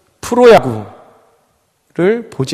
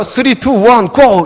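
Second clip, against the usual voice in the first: put-on high, loud countdown like a kid launching a top; hammed-up kids' launch call; countdown shout; 7 semitones higher, 5 dB louder, slower than usual. Kids launching spinning tops.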